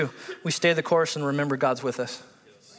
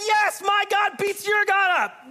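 higher, slow mock taunt; mock boast; 13 semitones higher, slower than usual. Preacher mocks the boast Daniel never made, hammed-up taunt. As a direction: ham up a gloating challenge. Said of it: "He didn't even say".

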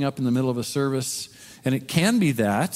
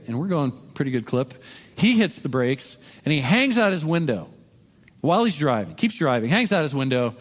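usual speaking voice

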